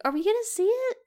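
high, loud mock-suspense question; eager anticipation. Viewer recalling the movie's most exciting part, eagerly. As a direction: blurt it out in hammed-up anticipation. Acting own suspense during film.

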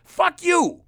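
loud, high burst of anger; angry retort. Real, not acted. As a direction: fire it back at the scolds, loud. Angry retort to mask scolds.

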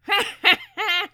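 high, loud bird cackle; piercing staccato parakeet laughter. A narrator's reading. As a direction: cackle shrilly like a small parrot. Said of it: "laughed the parakeet".

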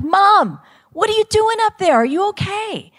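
very high, loud call to someone far up; alarmed calling up. Retold or imitated. Daughter calls up to her mom on the roof, high alarmed shout.

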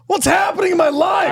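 high-pitched mock freak-out shout; panicked outburst. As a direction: shout it as a hammed-up existential crisis. Acted freak-out after a slip.

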